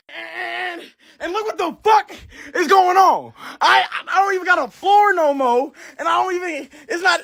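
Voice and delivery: cry-yelling in genuine frustration; real furious crying shout. Real, not acted. Ranting to TikTok about the bean-covered room, crying out in fury. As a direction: near tears and shouting at the ruined floor. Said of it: "I'm mad as fuck".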